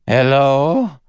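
high, loud searching call. A narrator's reading. Woman alone in the jungle, calling for companions. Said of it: "she called".

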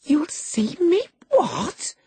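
very high, slow, incredulous question; shocked surprise. A narrator's reading. Girl questioning the lad's curse, high astonished voice. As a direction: ask it in high girlish disbelief. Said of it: "asked Catherine in surprise".

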